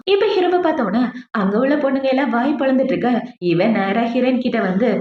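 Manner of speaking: usual speaking voice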